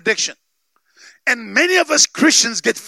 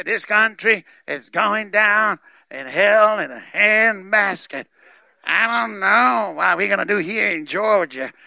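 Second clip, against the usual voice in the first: put-on Southern accent; 4 semitones lower than usual. Preacher voicing grumbling friends to the congregation, mock Southern accent. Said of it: "You like my southern accent?".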